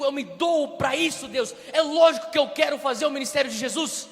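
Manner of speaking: high, loud, fervent outcry; desperate pleading shout. Retold or imitated. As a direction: cry out to God in frustration. Preacher retells pleading with God.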